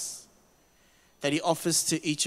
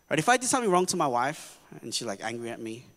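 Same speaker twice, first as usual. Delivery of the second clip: normal talking voice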